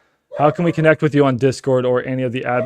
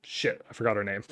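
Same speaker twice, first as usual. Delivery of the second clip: usual speaking voice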